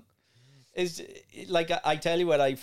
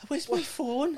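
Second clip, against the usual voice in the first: mock panic; 12 semitones higher than usual. Panicked phone addict acted out.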